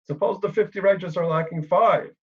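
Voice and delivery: skilful haggling. Acted. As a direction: lightly voice a negotiator floating an offer. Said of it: "how he says it".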